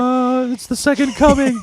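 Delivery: high-pitched awestruck witness, hammed up; playful high proclamation; mock religious awe. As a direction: wail it like a mock believer at a miracle. Riffing as awestruck witness of miracle.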